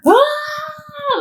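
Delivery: long, high, loud held scream; sustained high-pitched mock scream; imitated scream. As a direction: act out waking up screaming in pain. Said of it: "I was screaming".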